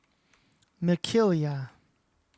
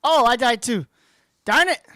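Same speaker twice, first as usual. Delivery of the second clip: loud, raised-pitch cry of dismay; frustrated outburst; 4 semitones higher, 12 dB louder than usual. Real, not acted. Gamer reacting to dying in a match, frustrated outburst. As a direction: blurt it out loudly in frustration.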